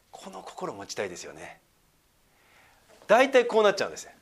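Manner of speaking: ordinary talk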